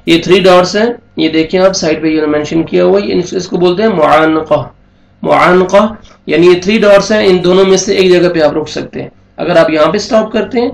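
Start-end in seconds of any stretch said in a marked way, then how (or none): none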